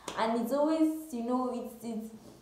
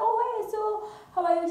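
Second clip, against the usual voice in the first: high-pitched breezy hello; put-on friendly opener, pitched high; acted casual greeting; 10 semitones higher than usual. Girl greeting her best friend before confronting her, high and casual.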